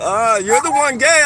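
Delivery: loud, mocking retort; loud call-out. Real, not acted. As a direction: shout a taunt. Arguing with a stranger.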